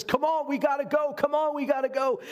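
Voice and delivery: high, pressing rallying call; acted urgency. Comrade in arms to an injured soldier in a ditch, acted urgency. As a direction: urge a fallen comrade to move.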